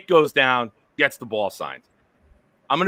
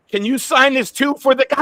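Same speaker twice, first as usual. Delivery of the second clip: mimicked kid; 9 semitones higher, 4 dB louder than usual. Kid asks Brees, mimicked.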